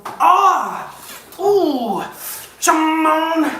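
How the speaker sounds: high, loud pop-star yelps. Acted.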